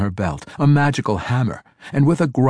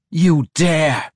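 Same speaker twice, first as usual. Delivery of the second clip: higher, louder furious cry; outraged cry; 7 semitones higher, 5 dB louder than usual. A narrator's reading. Matron mother cries out at the girl, outraged cry. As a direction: hurl it out in outrage. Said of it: "Quenthel cried".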